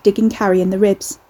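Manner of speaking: ordinary delivery, nothing marked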